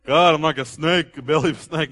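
a touch of mournful lament; David voiced as a downcast psalmist; down in the dumps. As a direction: moan it like a mild mock complaint. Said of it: "he was so down in the dumps".

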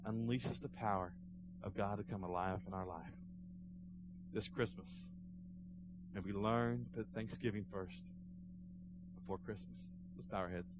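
soft, low lead-in to prayer; hushed preaching. Real, not acted. Pastor leading congregation into prayer, hushed. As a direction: settle the room down, with long pauses.

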